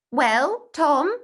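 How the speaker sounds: schoolboy calling out eagerly; crying out. A narrator's reading. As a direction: call out across the room to Tom. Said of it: "cried Bertie".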